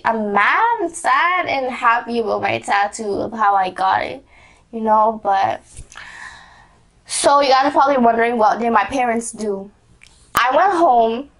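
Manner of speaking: usual speaking voice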